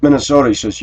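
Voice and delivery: normal talking voice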